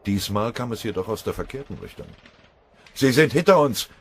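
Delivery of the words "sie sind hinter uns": high, very loud alarm shout; roared warning. A narrator's reading. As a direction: bellow a warning to your comrades. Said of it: "brüllte Mörschinger und sprang auf". (laut gebrüllte Warnung)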